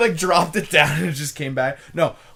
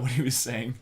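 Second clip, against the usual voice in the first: ordinary delivery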